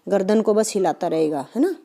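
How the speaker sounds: ordinary talk, nothing marked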